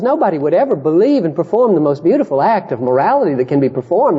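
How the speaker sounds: ordinary talk, nothing marked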